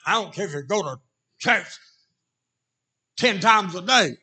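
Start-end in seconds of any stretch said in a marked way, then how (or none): none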